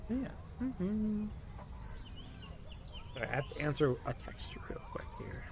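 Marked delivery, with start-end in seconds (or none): 3.1-5.4 hushed, unvoiced aside while texting; whispered aside. Real, not acted. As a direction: whisper it quickly. Aside while answering a text.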